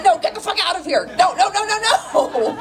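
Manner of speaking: loud, high, rising shoo; shooing him off. Retold or imitated. Comedian shooing the man away like a coyote, loud and rising. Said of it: "It's like".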